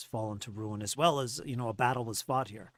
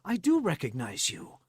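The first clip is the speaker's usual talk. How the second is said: higher, halting accusation; confronting Farley Tell; 8 semitones higher than usual. Acted. Farley recognizing the gnome who held him, higher and halting. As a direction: accuse your captor, pitch climbing. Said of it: "I said".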